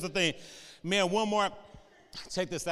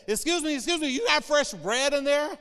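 higher, louder pushy woman calling out; 7 semitones higher, 5 dB louder than usual. Retold or imitated. Woman who followed the bread truck, calling to the driver, high and eager. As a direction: chase him down with excuse me, high and eager. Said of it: "and she said".

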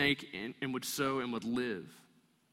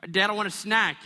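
put-on whiny kid; 6 semitones higher, 8 dB louder than usual. Kid whining in the car.

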